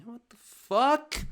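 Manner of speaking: high, slow stunned cry; shocked soldier. Pretend. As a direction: gasp it like a war-dazed soldier. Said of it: "Scene from Saving Private Ryan".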